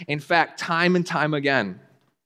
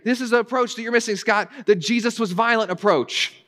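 higher, fast, mildly smug correction; know-it-all critic; 9 semitones higher than usual. Retold or imitated. Preacher mimicking critics correcting him, mildly smug and higher. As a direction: play the one who's sure he's right. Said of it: "They will say".

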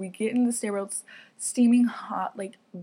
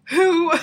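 high, loud celebratory whoop; 9 semitones higher, 10 dB louder than usual. Real, not acted. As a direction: whoop it out over the funny memory. Whoops while laughing about the trip.

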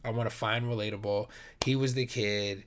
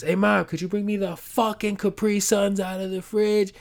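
higher, louder mock brat demand; bratty showing-off kid; 10 semitones higher, 6 dB louder than usual. Teenager showing off by cursing at mom, bratty mock voice. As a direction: play the cocky kid cursing at his mom. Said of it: "they're showing off".

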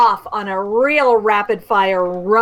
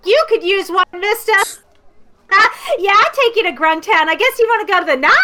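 put-on high, sassy street kid; cheeky kid; 10 semitones higher, faster than usual. Rickshaw kid sasses the customer in a high kid voice. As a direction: talk back like a cocky urchin.

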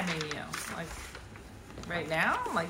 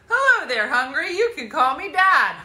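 hammed-up cheesy dad, louder; embarrassing dad joke; 3 semitones higher, 6 dB louder than usual. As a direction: ham it up like a proud dad. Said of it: "That's a classic dad joke".

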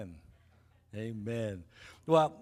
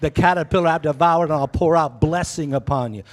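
rising, louder proclamation; God's promise thundered to the church; preaching scripture; 2 semitones lower, 6 dB louder than usual. Acted. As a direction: build the verse into a loud declaration. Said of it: "Joel two says, God says".

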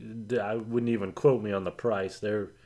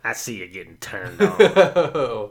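hag waitress voice; 8 dB louder, slower than usual. Pretend. Hag voice slow.

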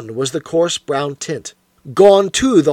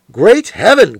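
a sudden louder, higher exclamation of shock; the inspector stunned at the unmasked face; astonished cry; 5 semitones higher, 7 dB louder than usual. Acted. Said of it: "cried the inspector".

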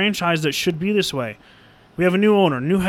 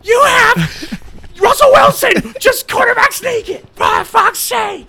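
high, loud, slow shouted disbelief; exasperated yell; 6 dB louder, slower than usual. Retold or imitated. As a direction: plead at the screen in disbelief. Fan raging at playcall.

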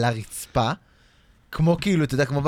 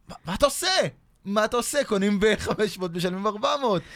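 mock yelling seller; 7 semitones higher than usual. Retelling a dream, the seller yelling at him, in a high mock yell. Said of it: "והמוכר צועק עליי".

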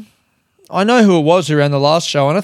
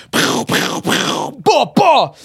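mock gunshot noises; 9 semitones higher, slower than usual. Sound effect for the announcement.

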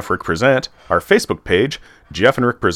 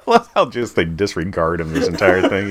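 ordinary talk, nothing marked

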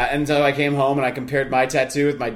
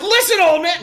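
tough talk; 13 semitones higher, 4 dB louder than usual. Pretend. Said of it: "No, I didn't say".

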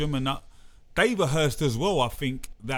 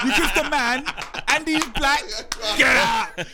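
angry mum; 6 semitones higher, 4 dB louder than usual. Pretend. Mates voice a disowning mum.

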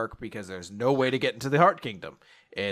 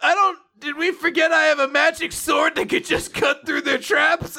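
high-pitched mock yell; incredulous protest; 13 semitones higher, 3 dB louder than usual. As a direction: shout it high like an exasperated cartoon hero. Acts out Asta's loud protest.

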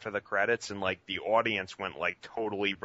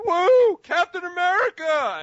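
much higher, much louder cheering shout; mock yell; 11 dB louder than usual. Imitating a theater heckler whooping, much louder. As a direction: whoop like a hyped moviegoer. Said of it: "someone yelled".

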